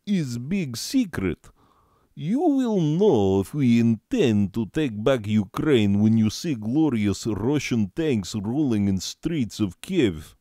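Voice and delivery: mock Russian accent as Putin; bragging Russian accent, rolling out the words. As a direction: play a hammed-up Russian strongman accent. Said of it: "I can do a voice or two, maybe an accent".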